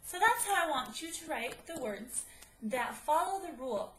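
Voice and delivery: ordinary talk, nothing marked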